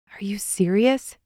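slow, drawn-out disbelief; weary sigh. A narrator's reading. Carl back from shopping, sighing in disbelief. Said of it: "Carl sighed".

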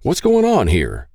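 indignant, stretched-out question; demanding. A narrator's reading. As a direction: demand an answer, drawing it out. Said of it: "he demanded".

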